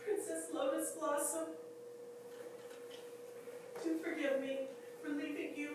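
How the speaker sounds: high, doting voice full of loss; fond, halting goodbye to a beloved pet; tender farewell. Acted. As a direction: coo lovingly upward, pausing between memories. Tender farewell to her dead dog.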